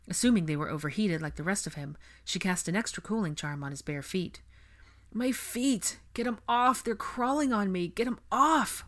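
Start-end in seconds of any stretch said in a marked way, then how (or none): 5.1-8.8 high, urgent, distressed plea; strained delirious whisper. A narrator's reading. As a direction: plead as if bugs are crawling on you. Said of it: "Blaze whispered".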